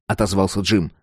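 ordinary delivery

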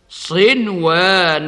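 صنوان with the nun clearly sounded before the waw. Shown as a demonstration. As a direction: say صنوان slowly, nun fully shown. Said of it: "هكذا". (نطق صنوان بإظهار النون)

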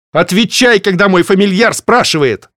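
loud, high commanding order. Acted. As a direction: bark the demand at the book. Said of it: "приказала я". (властный приказ)

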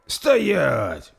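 loud, high command. Acted. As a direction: bark the halt order. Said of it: "скомандовал Торстейн".

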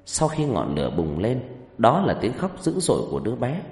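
story narration. A narrator's reading.

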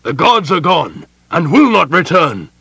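loud, high outburst of fury; furious, ringing denunciation; hollow, furious. A narrator's reading. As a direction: thunder the denial angrily. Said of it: "in a hollow, furious voice".

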